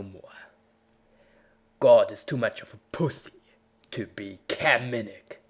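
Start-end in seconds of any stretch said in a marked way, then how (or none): none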